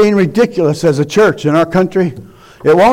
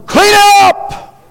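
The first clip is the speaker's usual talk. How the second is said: much higher, slow booming divine command; God commanding loudly; 11 semitones higher, slower than usual. Acted. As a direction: shout it as God ordering a cleanup. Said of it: "God is".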